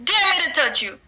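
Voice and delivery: a lightly voiced demon mocking; taunting demon. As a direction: goad him to dare you. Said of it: "He said".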